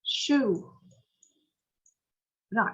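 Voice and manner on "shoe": Shoe with the u held long. Shown as a demonstration. Teacher models Shoe for students, long vowel. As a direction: stretch the oo in Shoe. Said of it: "kéo dài hơn chút xíu".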